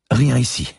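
put-on high old lady's relief; relieved. A narrator's reading. Old lady searching behind the frames, relieved. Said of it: "souffla-t-elle avec soulagement".

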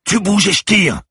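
high, loud shout at gunpoint; full-voiced armed warning, pitched high; yelled threat. A narrator's reading. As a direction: yell it at a fleeing suspect. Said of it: "hurla Lucie en le braquant".

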